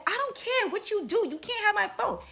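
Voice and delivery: high-pitched imitation of defiance; hammed-up stubborn girl; mock defiant refusal. Girl's acted refusal in quarrel.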